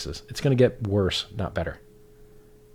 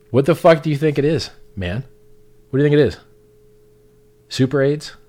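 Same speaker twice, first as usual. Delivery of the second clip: exasperated; 5 semitones higher, 5 dB louder than usual. Real, not acted. Exasperated rant.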